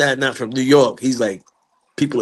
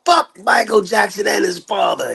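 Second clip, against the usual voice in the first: high, furious retort; mad; 8 semitones higher than usual. Retold or imitated. Father answering his screaming sons, mad. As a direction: imitate a father cursing back in anger. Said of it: "that's how mad my father is".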